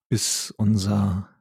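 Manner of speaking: ordinary delivery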